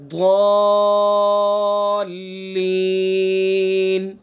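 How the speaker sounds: ضالین with the long vowel held very long before the doubled lam; recited example. Shown as a demonstration. Teacher to students, stretching ضالین to show the madd. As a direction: hold the madd in ضالین for several beats like recitation. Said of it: "جیسے".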